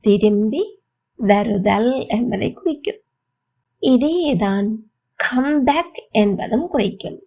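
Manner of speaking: usual speaking voice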